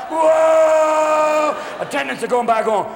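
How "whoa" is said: high loud drawn-out wail; hammed-up scream of a woman in labor; mock labor scream. Wife screaming on gurney.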